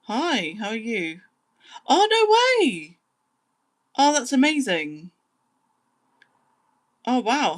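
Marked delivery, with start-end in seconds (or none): 1.9-7.6 high, loud, drawn-out astonishment; really thrilled, stretch the first exclamation out high; delighted amazement. Real, not acted. Streamer reacting to a viewer's news, amazed and delighted.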